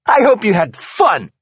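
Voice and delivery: high, loud sarcastic yell; shouted sarcasm; bitter yell. Acted. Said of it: "she yells".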